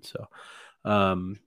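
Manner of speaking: usual speaking voice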